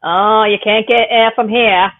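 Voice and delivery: slow, hammed-up Down East drawl; mock Maine accent. Joking with a friend about Maine, a slow mock Maine drawl. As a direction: play the old Maine local giving directions.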